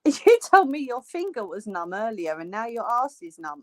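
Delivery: usual speaking voice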